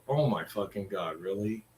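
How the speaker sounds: aunt's unimpressed oh my God, quietly imitated; imitating aunt. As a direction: drop the voice low and soft, deadpan, as the aunt. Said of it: "The only thing she would do is go".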